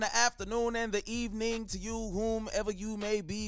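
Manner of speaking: loud, high, pumped-up host opening; hype show intro. Acted. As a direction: fire up the crowd like a hype man. Hyped podcast show intro.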